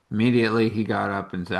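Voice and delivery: ordinary talk, nothing marked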